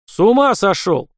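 loud, high angry shout; angry outburst. A narrator's reading. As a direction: flare up and shout at him. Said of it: "вскинулся Васильев".

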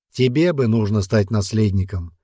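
ordinary delivery, nothing marked

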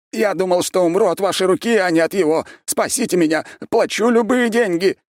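high, loud desperate begging; squealing plea. A narrator's reading. Prisoner begs the archmage to save him, squealing. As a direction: beg for your life in a shrieking pitch. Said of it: "взвизгнул Шустов".

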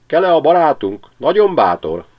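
high, loud, chirping cheer; csiripelő verebek. A narrator's reading. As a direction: cheer the stork like a flock of sparrows. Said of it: "csiripeltek Csuriék". (a verebek lelkesen csiripelnek, magas hangon)

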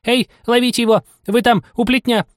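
high shouting rabbit. Acted. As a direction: yell orders like a rabbit. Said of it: "отдельный голос кролика".

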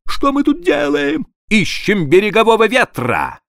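high frantic accusing voice; first mate attacking the captain in terror; panicked mate. A narrator's reading. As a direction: hurl blame in panic. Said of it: "набросился на меня".

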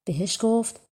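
plain reading voice

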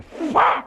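high sharp whip-crack sound. Retold or imitated. The Roman whipping the mariquita, a sharp crack.

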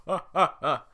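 booming mocking laugh; big hearty ogre laugh; loud ogre laugh. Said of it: "Shrek sneaks up behind them and laughs".

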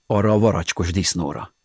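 ordinary delivery

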